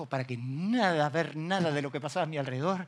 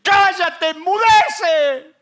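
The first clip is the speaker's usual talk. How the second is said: slow, much higher and louder rebuke; commanding shout; 15 dB louder, much slower than usual. Retold or imitated. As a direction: command the river to be still. Third rebuke of river. (grito de mando, lento y muy agudo)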